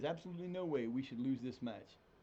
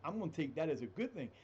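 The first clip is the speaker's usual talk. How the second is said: ordinary talk, nothing marked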